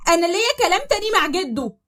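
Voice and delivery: تهديد بصوت أعلى وأقوى; ارمي التهديد في وشه; تهديد غاضب. Acted. (تهديد غاضب عالي)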